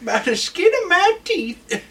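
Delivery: very high, strange cartoon voice on the edge of laughter; weird silly voice. Co-host riffing on the old-man threat, weird high voice. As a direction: squeeze it out absurdly high, cracking up. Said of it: "the weirdest noise".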